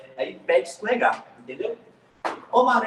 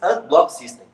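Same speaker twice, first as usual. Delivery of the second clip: loud, showy English with a Brazilian accent; 3 semitones higher, 7 dB louder than usual. Shown as a demonstration. Teacher repeating the term for Pedro, showy loud English. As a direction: punch out the English term to show off. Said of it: "agora você gastou no inglês".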